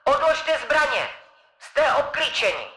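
high, distant loudspeaker call; tinny far-off megaphone order; police megaphone. Acted. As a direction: announce it through a helicopter megaphone. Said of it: "z jejího tlampače se ozvalo".

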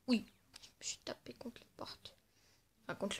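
whispered muttered aside; whispering. Real, not acted. Gamer admits he walked into walls, whispering. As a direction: whisper it to yourself.

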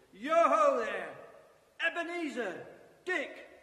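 loud, slow, rich jovial call. Acted. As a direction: call out like a fat jolly boss. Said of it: "comfortable, oily, rich, fat, jovial voice".

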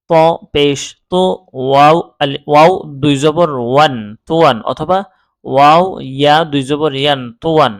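slow, measured syllable-by-syllable recitation; spelling drill. Shown as a demonstration. Teacher showing learners double zabar, slow spelling chant. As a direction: spell it out slowly, holding each letter name. Spells Arabic words aloud.

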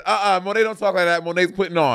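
mock scolding. Quoting the co-host's scolding.

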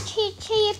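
high little chick peeps; chick cheeps. Acted. Ducklings answering their mother, high chick peeps.

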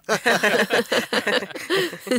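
long rolling chuckle; hearty laugh. Acted. Neighbour joking with a visiting friend, hearty laugh.

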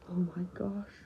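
hushed, low disbelief; stunned whisper. Acted. Friend takes in broken Valtryek, stunned whisper. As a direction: whisper it in shock.

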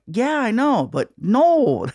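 high, swooping yeah and no; apologetic firm refusal. Retold or imitated. Comic refusing his agent's second push, high-pitched reluctance. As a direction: voice rising high as you beg off.

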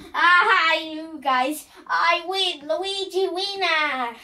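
put-on triumphant kid gloat; gloating win. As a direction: crow about winning to the others. Luigi gloats over his win.

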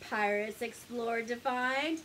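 usual speaking voice

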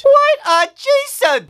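high, slow horrified wail; horrified outcry. Acted. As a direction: wail it in horror.

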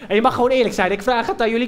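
normal talking voice

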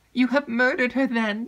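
high, loud, stricken accusation; hollow, inward. A narrator's reading. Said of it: "in a hollow and inward voice".

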